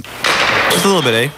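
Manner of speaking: slow, playful Canadian "eh"; mock Canadian accent. Guest teasing about Canadian eh, mock Canadian. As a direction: lightly play up the Canadian accent.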